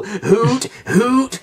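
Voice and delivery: high, drawn-out spoken hoot; owl hoot. Acted. Bad little owl apologizing to the doctor, high owl hoots. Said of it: "That's the sound owls make".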